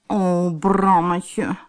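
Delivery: slow, drawn-out disappointed sigh. Acted. Theodora sighs to Brammetje after the failed spell, slow sigh. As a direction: let the name sink out on a sigh. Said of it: "zuchtte Theodora".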